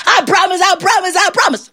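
very high, pleading repetition; pleading in a high, desperate voice; frantic promising. Acted. Trapped devil promises John anything, frantic.

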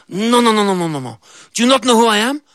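high goat, indignant. Acted. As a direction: goat scoffing at her.

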